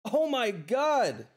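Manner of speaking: slow, high, drawn-out oh my God; stunned. Real, not acted. Streamer reacting to a huge donation, stunned and drawn out. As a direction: let the disbelief stretch every word.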